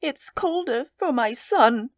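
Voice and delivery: put-on high, slow grieving old woman; weeping. A narrator's reading. Old woman grieving her son to husband, weeping. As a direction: lament in a high, acted wail. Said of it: "and wept afresh".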